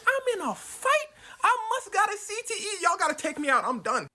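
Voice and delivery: squeaky high panicked voice; panicked fighter. Retold or imitated. Rodriguez begging his corner in high panic. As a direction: play a fighter losing his nerve.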